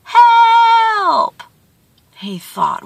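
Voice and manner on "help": long high call echoing back; drawn-out, high echo of a cry; distant echo. A narrator's reading. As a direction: mimic a cry coming back from far away. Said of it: "from the distance, he heard".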